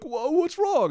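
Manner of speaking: very high, loud bewildered squeal; mock alarm. Clueless gardener seeing his plants wilt, high mock alarm. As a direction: play helpless shock way up in pitch.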